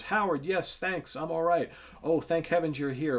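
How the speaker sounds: high-pitched comic-book damsel; relieved heroine. Acted. Voices Sally on waking, relieved.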